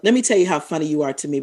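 ordinary talk

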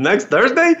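can't believe the date; excited disbelief. Retold or imitated. Student repeating the assistant's date back to her, disbelieving. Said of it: "I was so excited".

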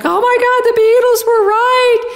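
very high, loud re-enacted shriek; squealed cry of amazed delight; ecstatic yelling. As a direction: yell it out as an overjoyed revelation. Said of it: "I'm laughing and I'm crying and I'm yelling".

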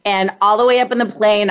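usual speaking voice

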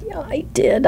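a soft whisper, gently breaking bad news; whispered confession to a friend who flopped; hushed reassurance. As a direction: whisper it like you're letting her down easy. Gently consoling after a bad interview.

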